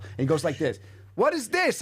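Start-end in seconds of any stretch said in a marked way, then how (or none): 1.1-1.8 high, loud startled question; startled clerk. Retold or imitated. Hotel clerk shocked at the folded twenty, high loud surprise. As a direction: catch him off guard.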